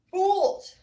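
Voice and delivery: very high, loud holler; explosive high shout on FOOLS. Real, not acted. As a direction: belt FOOLS out at the top. Repeats the word, now shouted.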